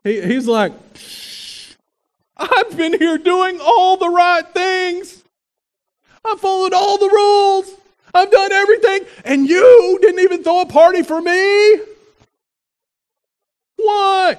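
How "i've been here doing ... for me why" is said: higher, whiny tantrum voice; temper tantrum. Retold or imitated. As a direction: whine like a sulking older brother. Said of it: "he throws a little tantrum".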